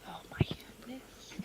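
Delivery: breathless whisper of dread. Real, not acted. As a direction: whisper it, hushed and tense. Player whispers at the roll.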